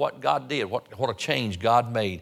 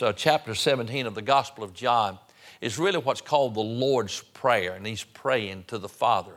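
usual speaking voice

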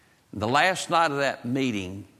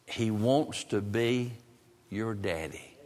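tender reassurance; 2 semitones lower, 4 dB quieter, slower than usual. Retold or imitated. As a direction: offer comfort gently, pausing before the last words. Consoling the boy about God's love.

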